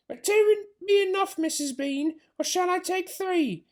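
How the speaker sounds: high put-on voice, asking; a maid checking with her mistress; big servant woman. Mabel asks Missus Bean how many jars, high put-on voice.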